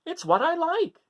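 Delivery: put-on high childlike voice; Gregory. Gregory answering his mother, high and simple. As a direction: say it simply like a small kid. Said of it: "said Gregory".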